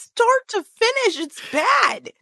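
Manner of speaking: very high, drawn-out exclamation; slow, high-pitched despairing verdict; exasperated wail. Real, not acted. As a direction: stretch it out in squeaky dismay.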